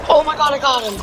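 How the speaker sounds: loud, high victory yell; excited shout. Real, not acted. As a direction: yell it out, thrilled by the kill. Surprise kill in game.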